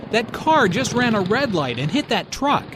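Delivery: high, urgent report; lots of energy. Acted. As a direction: blurt it out, alarmed. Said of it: "is said with a lot of energy".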